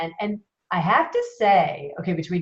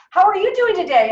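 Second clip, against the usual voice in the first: higher, louder sales caller; a bright, pushy caller voice; chirpy caller voice; 11 semitones higher, 6 dB louder than usual. Retold or imitated. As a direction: imitate a pushy phone rep's cheery opener. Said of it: "And she goes".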